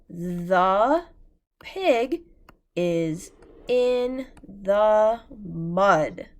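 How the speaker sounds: the pig is in the mud read word by word, very slow and loud; slow choral reading. Shown as a demonstration. Teacher reading the sentence together with class, slow and loud. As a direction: read it slowly so the class can follow. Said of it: "let's read that sentence together".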